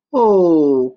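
loud desperate "Oh"; frightened cry. A narrator's reading. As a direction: cry out in terror. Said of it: "cried Akatapi".

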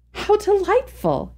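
high, slow, thrilled cry of delight; delighted exclamation. A narrator's reading. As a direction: gush with delight. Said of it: "she exclaimed".

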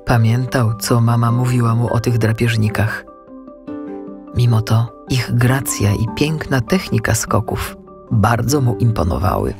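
plain reading voice

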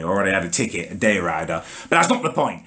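usual speaking voice

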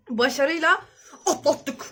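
laughing through the words; relieved laughter. Real, not acted. Laughing after finishing the chili.

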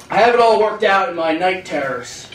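normal talking voice

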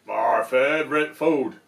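loud, lightly voiced monster; hint of a monster. Gruffalo threatening the mouse, mildly booming. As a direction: boom it a little like a hungry beast.

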